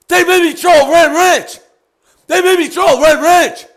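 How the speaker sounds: loud, high frantic yelling; alarmed realization. Real, not acted. Streamer realizing chat tricked him, frantic shouting. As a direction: shout it as the penny drops.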